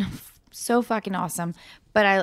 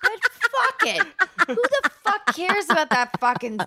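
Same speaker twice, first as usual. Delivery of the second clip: mock defiance; 8 semitones higher than usual. Real, not acted. To her co-star about never winning an Oscar, mock defiance. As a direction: fling it off with a hooting laugh.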